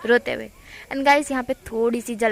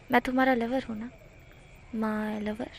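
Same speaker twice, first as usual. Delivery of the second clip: much softer, fading toward my lover; soft lover's line; 6 dB quieter than usual. Acted. Wing calling himself Wangji's lover, softly. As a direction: murmur it close and intimate. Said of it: "इस तरह से wing बोलता है".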